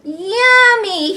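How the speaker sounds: high, loud delighted yummy; buzzed. Acted. Greedy bee gloating over the meadow, loud and high. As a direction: squeal with greed. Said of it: "he buzzed".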